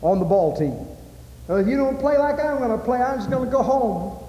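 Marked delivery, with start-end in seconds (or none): 1.5-4.2 put-on high whiny kid voice; sulky kid. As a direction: mimic a pouting boy threatening to leave. Imitating a sulking ball player.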